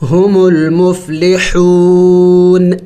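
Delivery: long, melodic ہم المفلحون; slow recitation. Shown as a demonstration. Teacher models the verse end for students, slow and melodic. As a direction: draw every vowel out like tilawat.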